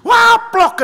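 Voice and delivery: high loud wail cut off by a spoken smack; hammed-up scream and slap noise; mock scream, slapped. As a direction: imitate a child's shriek ending in a slap. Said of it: "gaplok ya gaplok".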